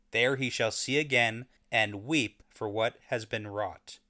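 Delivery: slow, low prophetic reading; dramatic reading. Shown as a demonstration. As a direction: read it like an ancient prophecy. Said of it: "read it for me".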